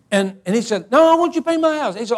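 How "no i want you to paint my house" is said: higher-pitched pleading insistence; insistent retold request. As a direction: push back, refusing to take no. Neighbour insists after being refused.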